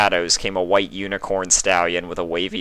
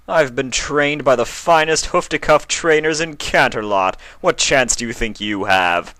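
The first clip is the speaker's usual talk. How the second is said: higher, louder smug boast; boastful prince; 6 semitones higher, 3 dB louder than usual. A narrator's reading. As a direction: brag to intimidate.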